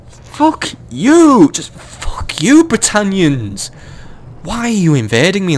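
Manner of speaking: high, drawn-out furious swearing; angry outburst. Real, not acted. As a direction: spit it out, high and slow. Betrayed by ally in game.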